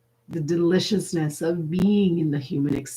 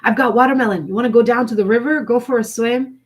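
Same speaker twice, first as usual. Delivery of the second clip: brighter and quicker inviting friend; excited friend; 5 semitones higher, 5 dB louder, faster than usual. Pretend. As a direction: pitch it up like a friend with a fun invite. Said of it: "text from your friend and says".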